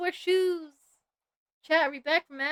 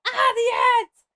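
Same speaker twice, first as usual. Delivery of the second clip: startled shout; 9 semitones higher, 8 dB louder, slower than usual. Real, not acted. Ads blasting in her ears.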